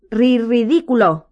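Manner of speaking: slow, loud, stammered spell; sobbing attempt at an incantation. A narrator's reading. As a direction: cry the spell out, high and stumbling. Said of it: "sollozó aún más fuerte".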